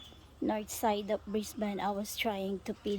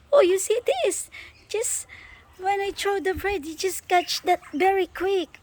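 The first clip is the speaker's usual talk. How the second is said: excited; 10 semitones higher, 9 dB louder than usual. Real, not acted. Excited about birds catching bread.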